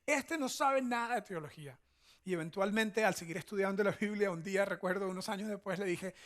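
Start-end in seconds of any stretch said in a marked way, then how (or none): 0.0-1.8 higher, dismissive self-mocking tone; mock his own past arrogance; a lightly voiced condescending believer; smug know-it-all. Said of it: "las tenía yo en mi mente para decir".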